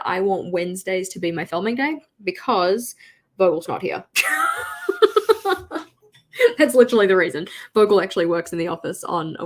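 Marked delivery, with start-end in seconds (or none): 4.1-5.8 bright burst of laughter; high laugh. Real, not acted. Laugh after joke.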